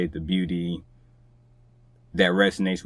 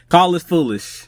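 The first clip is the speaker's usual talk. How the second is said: higher and louder playful jab; 9 semitones higher, 7 dB louder than usual. Real, not acted. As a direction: tease the listener cheerfully. Repeats her playful catchphrase.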